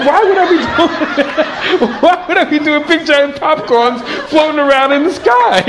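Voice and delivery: amused what-are-you-thinking retort; laughing disbelief. Retold or imitated. Incredulous retort to onlookers.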